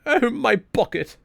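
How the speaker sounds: high, slow mock British lament; mock British accent. Player mimicking the narrator's British accent, slow lament. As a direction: sigh it out in a put-on British accent. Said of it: "saying bucket in that accent".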